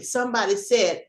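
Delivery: normal talking voice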